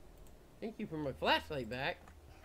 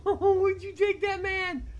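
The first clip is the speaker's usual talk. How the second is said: high, loud alarmed cry; real fear, calling out; frightened shout; 10 dB louder than usual. Real, not acted. Player asking the monster where the man went, frightened.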